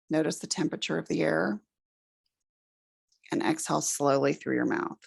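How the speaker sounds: calm, unhurried breathing-exercise guidance; guided meditation. Acted. Teacher guiding the class through a breathing exercise, calm meditation voice.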